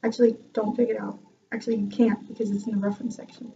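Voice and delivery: usual speaking voice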